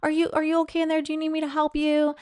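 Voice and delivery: high mock-fussing husband at the bathroom door; mock doting husband. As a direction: overplay the smothering husband. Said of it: "the door saying".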